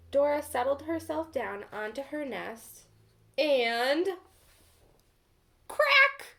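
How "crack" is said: loud, high cracking sound; sharp crack. A narrator's reading. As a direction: snap out the crack of a breaking egg. Egg cracking under the hen.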